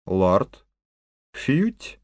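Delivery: a curt whistle call to a dog; curt command. Acted. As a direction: summon him curtly like a dog. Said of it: "кратко и повелительно сказал".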